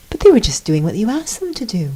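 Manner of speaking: high, quick, guileless defence; sweet and innocent. Retold or imitated. Jesus defending the two henchmen, sweet and innocent. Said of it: "so sweetly".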